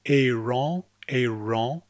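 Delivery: AARON with an English R, rising at the end; anglicised AARON with a hard American R. Shown as a demonstration. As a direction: say AARON the English way, tongue tip pulled back for the R. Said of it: "you're naturally going to say".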